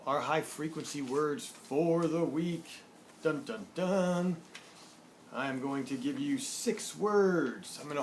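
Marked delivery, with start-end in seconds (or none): 3.2-4.4 slow mock-suspense fanfare sting; dramatic "dun, dun, dun". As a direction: voice a playful drumroll reveal, higher. Mock fanfare before new words.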